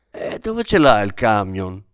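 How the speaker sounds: plain reading voice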